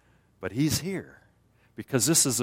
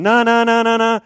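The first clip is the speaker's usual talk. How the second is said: high, loud frantic refusals; resisting no; 12 semitones higher, 10 dB louder than usual. Retold or imitated. Sinner refusing the Spirit, loud high no. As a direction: push it away, loud and high. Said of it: "what we're really doing is going".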